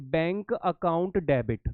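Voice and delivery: slow, measured dictation of an entry. Real, not acted. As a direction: spell it out word by word for note-takers. Dictating the entry while writing it.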